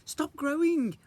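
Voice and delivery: high, loud stop; urgent command. Acted. Isadora ordering the carrot, urgent and loud. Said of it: "I said to it".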